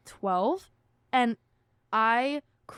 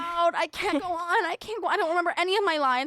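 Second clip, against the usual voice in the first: higher, fast hammed-up panic before the show; mock stage fright; 7 semitones higher, much faster than usual. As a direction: play a kid freaking out about forgotten lines. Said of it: "now I'm remembering".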